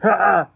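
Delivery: sharp high scare bark; loud scare shout. Acted. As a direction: jump out with a loud ha. Jump scare in Scarecrow costume.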